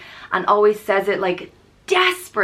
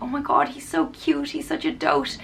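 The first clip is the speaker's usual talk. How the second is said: lightly voiced girls cooing, higher and softer; a hint of girls gushing behind his back; imitating gossiping girls; 7 semitones higher, 4 dB quieter than usual. Girls cooing about an old man, higher softer gush. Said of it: "heard some girls ... calling".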